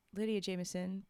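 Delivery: plain reading voice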